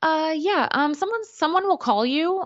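high, halting nurse voice dodging bad news; hesitant nurse. Nurse on the phone stalling about her mom's test. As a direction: hem and haw like a nervous nurse.

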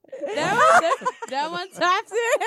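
high, loud words breaking into laughter; laughing. Real, not acted. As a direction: crack up while saying it. Friend cracks up at story.

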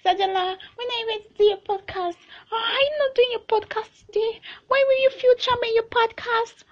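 high, teasing imitation of a nagging younger brother; mock brother voice. Brother pestering her about the podcast, in a high mock voice. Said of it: "My brother will always say".

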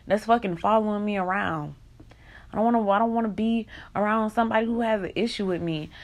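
ordinary talk, nothing marked

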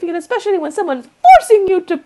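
high, loud, fast mockery; mocking preachy voice. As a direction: send up the preachy message. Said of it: "it's like".

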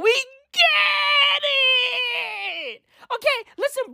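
mock-anguished shout at Twitter; wailing shout. Real, not acted. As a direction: cry it out to the sky.

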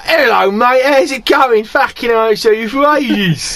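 matey Cockney, trying to impress; Cockney geezer voice. Retold or imitated. Greeting the mechanic who fixes the car, Cockney geezer voice. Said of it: "like Mickey Pearce".

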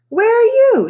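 high, loud shout into the trees; girl shouting for lost friends. A narrator's reading. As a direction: yell it up to find them. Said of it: "she shouted".

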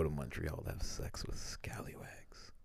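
hushed whispered aside. Real, not acted. Sharing a cheeky joke with his cohost, whispered. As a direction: whisper it like a naughty secret.

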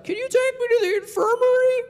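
very high, loud mock wail; hammed-up whiny child's plea; bawling kid. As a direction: squeal it like a panicked kid putting on tears. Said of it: "he literally starts bawling".

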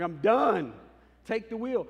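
a loud, exasperated "Take the wheel"; exasperated outburst. Retold or imitated. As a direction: throw up your hands and shout "Take the wheel". Acting out walking off in exasperation.

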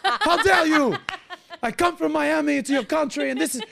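put-on high, loud indignant shouting in French accent; mock French outrage. Tourist berating a rude French girl, mock-outraged French accent. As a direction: yell hammed-up offence in a fake French accent.

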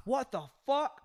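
high, outraged yell; raging gamer. Acted. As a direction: scream in disbelief after dying. Raging victim keeps going.

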